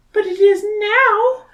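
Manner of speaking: put-on very high girlish Mina voice. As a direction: squeak it out like a young girl.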